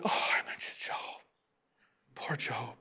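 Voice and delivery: unvoiced, secretive stage whisper; mock whisper. Preacher voicing God's mock innocence in a whisper. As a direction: hush it like a sly aside.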